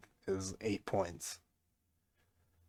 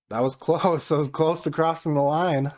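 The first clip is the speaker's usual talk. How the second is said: excited; 6 semitones higher, 5 dB louder than usual. Real, not acted. Near miss in football game.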